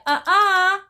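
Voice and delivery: high, loud uh-uh; indignant refusal. Retold or imitated. She refuses again.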